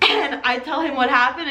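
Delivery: usual speaking voice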